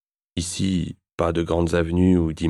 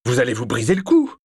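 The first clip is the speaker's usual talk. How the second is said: higher, louder alarmed shout; 6 semitones higher, 4 dB louder than usual. Acted. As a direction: yell it in panic. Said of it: "hurle-t-il". (il hurle, affolé)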